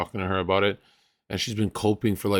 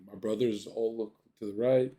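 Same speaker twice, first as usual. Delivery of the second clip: ordinary delivery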